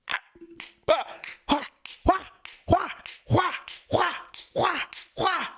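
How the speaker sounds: high, odd-voiced "wha wha" noises; playful sound effects of someone getting hit; imitated blows. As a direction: fire off mock impact sounds. Imitating a courtroom onslaught.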